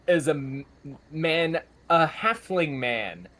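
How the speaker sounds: normal talking voice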